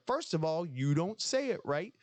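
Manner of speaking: imitating the movie lecture; movie character voice. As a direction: lay out the rules in a put-on voice. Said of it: "He said".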